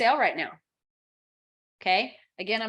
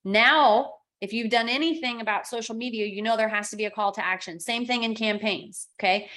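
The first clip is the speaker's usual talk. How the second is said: ordinary talk, nothing marked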